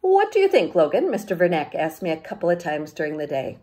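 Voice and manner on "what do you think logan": higher, louder teacher voice calling on Logan; a lively teacher putting a student on the spot; teacher asking. Acted.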